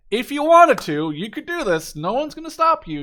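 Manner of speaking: high, loud and gleeful; giddy, delighted hype; excited disbelief. Real, not acted. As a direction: sell the absurd overpowered build with glee. Hyping the paratrooper spam.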